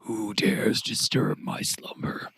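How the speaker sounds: raspy whispered creature voice; hissing reptile growl. Acted. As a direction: hiss it from the dark. Weasel voice from dark closet.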